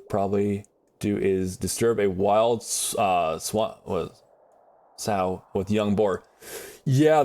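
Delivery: normal talking voice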